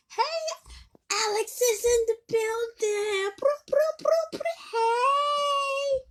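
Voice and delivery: very high, loud arrival fanfare; high-pitched celebratory whoop with imitated horn noises; playful hype announcement. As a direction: herald a friend's entrance in a mock-squeal. Hyped announcing of Alex's arrival.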